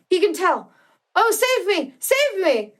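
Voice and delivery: high, panicked cries for help; terrified plea. A narrator's reading. Victor imagining the spectre in the room, a high frantic plea. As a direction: cry out in rising terror. Narrator begs rescue from imagined monster.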